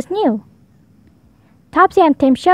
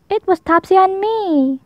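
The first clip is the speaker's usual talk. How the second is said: sadly; 7 semitones higher than usual. A narrator's reading. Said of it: "said Tim sadly".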